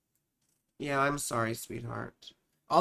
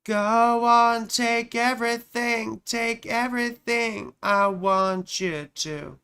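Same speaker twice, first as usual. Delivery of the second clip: higher, louder, slow dramatic delivery; theatrical declaration; 5 semitones higher, 5 dB louder, slower than usual. Pretend. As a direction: proclaim it like a stage heroine. Dramatic recited line.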